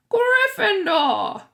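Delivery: very slow, loud high shout; hat shouting. A narrator's reading. The hat announcing Ron's house, drawn-out shout. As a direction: stretch it out to the hall. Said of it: "the hat shouted".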